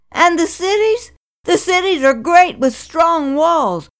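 fearful cry. Acted. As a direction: ten spies warning the people off, fearful and loud. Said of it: "But ten of the spies cried".

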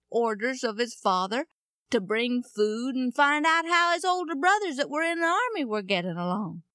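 plain reading voice

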